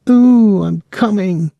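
high wailing fake ecstasy; loud falsetto "ooh" easing down, mock-swooning; mock passion. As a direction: squeal it in hammed-up rapture. Boys act out lovers to provoke.

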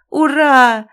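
loud, high cry of delight; joyful shout. A narrator's reading. Son cheering he won't go to school, loud and high. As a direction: cheer out loud like a happy kid. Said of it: "закричал обрадованно сын".

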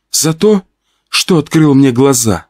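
lightly voiced woman; Olya's voice. Narrator answering Pyotr, lightly in Olya's voice. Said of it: "ответил я голосом Оли".

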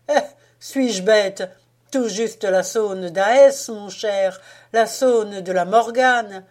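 slow, loud eureka; triumphant. A narrator's reading. Louarn names the song, triumphant and slow. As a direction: savour the discovery out loud. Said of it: "avec un geste de triomphe".

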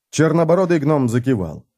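ordinary delivery

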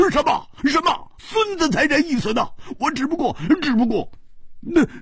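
a panicked, stuttering denial; flustered protest. Acted. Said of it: "蝴蝶花着急地说". (着急得语无伦次，高声辩解)

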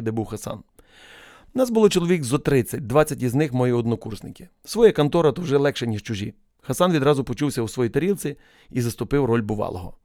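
ordinary delivery, nothing marked

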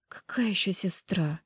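slow, drowsy whispered mumble; sleepy whisper. A narrator's reading. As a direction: whisper it half asleep. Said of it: "сонно пробормотала я".

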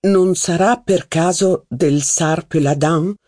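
ordinary delivery, nothing marked

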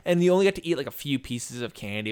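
ordinary talk, nothing marked

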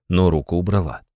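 plain reading voice

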